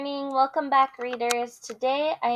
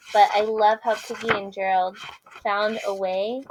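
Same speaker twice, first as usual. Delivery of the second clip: usual speaking voice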